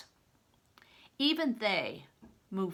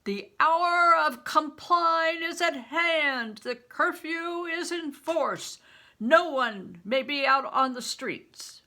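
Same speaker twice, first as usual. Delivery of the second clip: loud, high, slow proclamation; proclaimed shout; 9 semitones higher, 11 dB louder than usual. Acted. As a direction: cry the curfew down the street. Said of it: "the soldiers shouted out".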